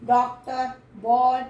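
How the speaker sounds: teacher calls out example words for the vowel. Shown as a demonstration. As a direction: stress the rounded "ऑ" in "डॉक्टर" and "बॉल". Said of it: "ये example".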